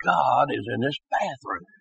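low, very soft and awestruck; hushed awe. Retold or imitated. Man realizing God spoke to him in the bathroom, hushed awe. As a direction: drop to a hushed, reverent undertone. Awed thought on hearing a voice.

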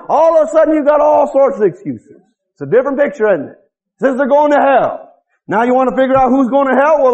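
normal talking voice

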